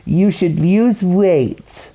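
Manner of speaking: high, drawn-out posh voice; mock a posh old biddy, pitched high and very slow; posh accent. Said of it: "in a posh way, she goes".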